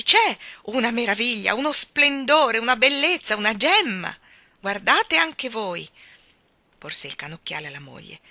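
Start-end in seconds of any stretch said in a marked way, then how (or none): 0.0-5.9 loud, thrilled marvelling; agitated rapture. A narrator's reading. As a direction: pile up praise excitedly. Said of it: "confermò agitandosi".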